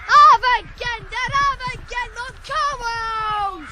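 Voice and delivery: loud urgent shouts urging the horses on; yelling at the horses. Acted. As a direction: drive them on at the top of your voice. Boy driving horses, yelling.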